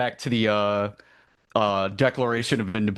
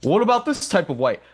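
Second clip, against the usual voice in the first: higher, louder cartoon voice asking; a raised, pushy cartoon question; Quagmire; 9 semitones higher, 4 dB louder than usual. Quagmire quizzing Peter at the signing, high cartoon voice. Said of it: "Quagmire asks him".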